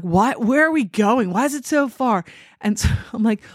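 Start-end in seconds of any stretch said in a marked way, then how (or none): 0.0-2.2 higher-pitched imitation of a complaining mother; groom's mom. Groom's mom questioning the walk down the driveway, imitated complaint. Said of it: "And the groom's mom's like".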